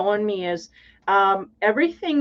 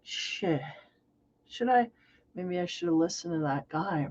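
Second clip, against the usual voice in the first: softer, muttered second thoughts; quiet regret; 3 semitones lower, 7 dB quieter than usual. Retold or imitated. Driver stuck at construction, regretting quietly. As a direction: mumble it, doubting yourself.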